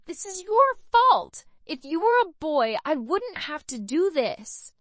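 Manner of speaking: high, loud furious accusation; furious outburst. A narrator's reading. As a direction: spit the blame at him. Carly's furious outburst at Zach.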